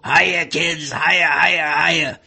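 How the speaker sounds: low-pitched puppet frog voice; Froggy the Gremlin. Retold or imitated. Puppet greets the kids in the audience, frog voice. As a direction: croak it out like a cartoon frog. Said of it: "low-pitched sort of frog voice".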